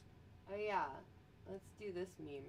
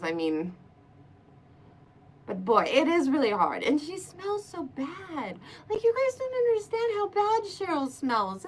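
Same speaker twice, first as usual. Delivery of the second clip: usual speaking voice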